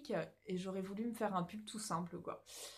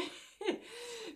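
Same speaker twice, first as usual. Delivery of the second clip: embarrassed laugh; 13 semitones higher, 4 dB quieter than usual. Real, not acted. Embarrassed after losing her thread.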